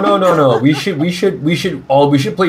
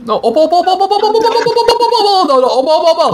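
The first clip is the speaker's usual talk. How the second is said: very high squeaky repeated calling; high pleading calls; much faster than usual. Real, not acted. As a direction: squeal her name over and over. Pleading friend not to leave.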